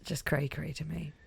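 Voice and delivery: whispering. Real, not acted.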